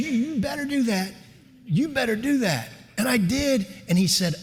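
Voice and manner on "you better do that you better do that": high, insistent Southern lady; Southern mom. Retold or imitated. Mother overruling her doubtful son, high and insistent. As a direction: imitate a Mississippi mother laying down the law, pitched up. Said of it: "good Southern lady from Mississippi".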